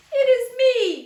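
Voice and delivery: high, loud call. A narrator's reading. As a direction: shout it up from the tree. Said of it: "called Zomo".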